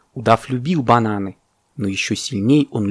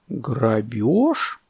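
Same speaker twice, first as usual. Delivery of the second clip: thoughtful boa. Acted. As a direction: mull the word over slowly. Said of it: "задумчиво сказал удав".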